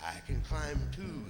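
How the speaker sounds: slow, drawn-out bear comeback; slow, menacing retort; confident bear. Acted. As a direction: answer unhurriedly as the big bear. Bear answers the marten.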